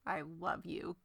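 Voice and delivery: very quiet, low murmur; mock confession. Fan mimicking Camilla's love confession, hushed mock murmur.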